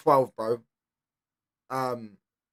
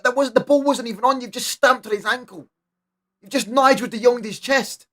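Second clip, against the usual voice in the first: higher, heated rant at a dirty tackle; worked-up rant; 9 semitones higher, 5 dB louder than usual. Real, not acted. Fan yelling at Melo over a red-card foul, heated and higher. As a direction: berate the player like a furious ref. Ranting at a foul, mock referee.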